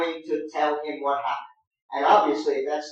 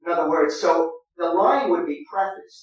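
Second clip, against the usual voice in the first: ordinary talk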